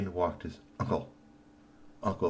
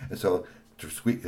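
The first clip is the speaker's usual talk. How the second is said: usual speaking voice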